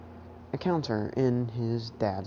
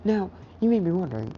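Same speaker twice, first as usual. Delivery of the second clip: ordinary delivery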